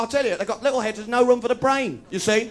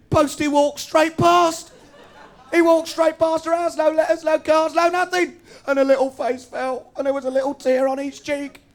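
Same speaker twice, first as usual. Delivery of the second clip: higher and louder, outraged storytelling; building outrage; 8 semitones higher, 4 dB louder than usual. Pretend. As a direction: wind up to an indignant peak. Mock-sentimental advert retelling.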